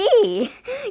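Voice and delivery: short rising giggle; nervous high laugh. Real, not acted. Nervous about the next candy.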